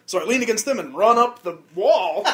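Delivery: ordinary talk, nothing marked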